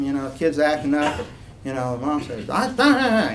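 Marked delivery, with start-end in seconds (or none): 2.5-3.3 high, loud warning noises; mom's scolding. Retold or imitated. Mom to a kid acting up, high-pitched scolding. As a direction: cut the child off with a sharp scold.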